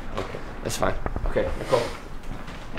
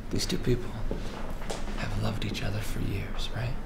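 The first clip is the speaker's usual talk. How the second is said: softer, unvoiced whisper; 3 semitones lower, 8 dB quieter than usual. Real, not acted. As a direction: hush it to draw them in. Director drops to a whisper.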